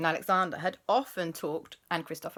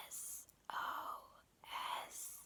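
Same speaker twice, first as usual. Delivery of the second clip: barely audible whisper, far softer; ghostly whisper; 19 dB quieter than usual. Acted. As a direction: breathe the letters like a ghost. Ghosts write in ketchup.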